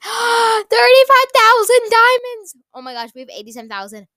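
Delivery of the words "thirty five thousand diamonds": high, slow gasping shout of amazement; excited gasp. Real, not acted. Girl gasping at a huge price, thrilled and loud. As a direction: gasp and cry out the price in awe.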